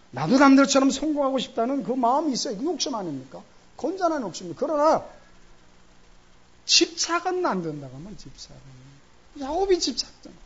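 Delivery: high, loud preaching build; fervent preaching. Real, not acted. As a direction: drive the point home to the congregation. Preaching at full force. (힘찬 설교조)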